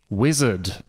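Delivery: ordinary delivery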